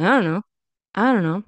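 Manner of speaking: "I don't know" slurred to "I-unno"; dropped D. Shown as a demonstration. Teacher imitating casual teenagers, D dropped. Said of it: "They kinda drop the D".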